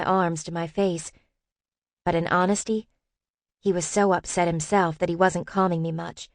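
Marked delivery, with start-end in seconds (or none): none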